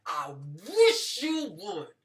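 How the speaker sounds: slow, loud menacing warning; menacing dare. Retold or imitated. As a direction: drag the dare out, daring him to move. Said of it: "my husband was like".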